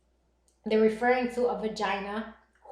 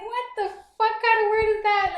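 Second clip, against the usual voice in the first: high, loud mock outrage; indignant retold outburst; losing it, retold; 11 semitones higher, 6 dB louder than usual. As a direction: replay exploding in disbelief. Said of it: "I just lost my mind".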